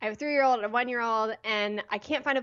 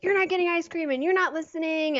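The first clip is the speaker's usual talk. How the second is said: mom snapping at her daughter, raised higher and louder; retold yelling; 6 semitones higher, 3 dB louder than usual. Mother reliving scolding her daughter on the beach, frustrated yell. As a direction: replay the frustrated yell at the kid. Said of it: "I started yelling at her".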